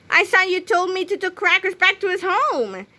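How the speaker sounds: high-voiced boy, accusing; a boy's indignant complaint; George voice.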